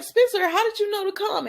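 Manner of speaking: high surprised question. Retold or imitated. Character surprised at arrival.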